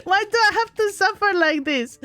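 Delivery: high, hammed-up whine of suffering; high theatrical self-pity; mock whining despair. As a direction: wail it like a melodramatic victim. Mock despair over boxes.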